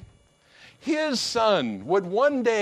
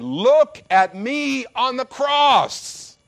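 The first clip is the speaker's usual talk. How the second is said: louder, slow, higher, earnest plea; slow and raised, pointing to the cross; Jesus pleading; 4 semitones higher, 6 dB louder, slower than usual. Acted. Jesus voiced to his hearers, slow loud plea. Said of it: "He's saying".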